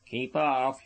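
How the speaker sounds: ordinary delivery, nothing marked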